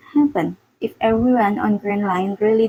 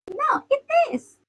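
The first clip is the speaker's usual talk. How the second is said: shouting back. A narrator's reading. Luffy shouting back in an argument, high-pitched. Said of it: "Luffy shouted back".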